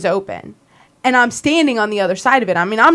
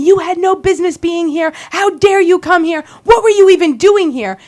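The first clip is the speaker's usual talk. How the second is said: higher, louder mock scream; retold screaming; 5 semitones higher, 4 dB louder than usual. Scott screaming at her, louder higher mock yell. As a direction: bark it at her face. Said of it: "And he's screaming at me".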